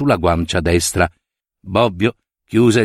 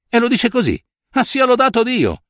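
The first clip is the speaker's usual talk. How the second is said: mock relieved praise; 12 semitones higher than usual.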